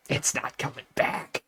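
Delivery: leaning in with a flat, hushed whisper; deadpan whisper. Retold or imitated. Tim Robinson leaning in to reassure a cancer survivor, whispered. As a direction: hush it close, dead serious. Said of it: "just leans in and just goes".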